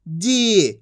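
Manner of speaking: "जी" with the ee held long; long drawn-out ee. Shown as a demonstration. Teacher answering what ja becomes, "जी" drawn out. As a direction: stretch the vowel of "जी". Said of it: "खींच के पढ़ा जाता है".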